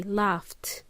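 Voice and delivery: "laughed" ending in a T, like "laft". Shown as a demonstration. Teacher showing students the sound, "laughed" ending in T. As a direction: say "laughed" with a final T. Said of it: "it sounds like a T".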